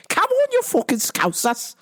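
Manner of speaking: high mock Scouse taunt; rally the Scousers, hammed up; Liverpool accent. Taunting Scousers in a high mock Liverpool accent. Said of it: "do a Liverpool accent".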